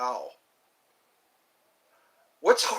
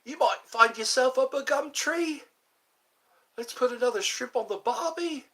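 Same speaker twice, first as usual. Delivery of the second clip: high-pitched mock Aussie slang voice; Australian accent; 10 semitones higher than usual. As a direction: play up a high Australian twang. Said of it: "I sometimes like the Australian accent".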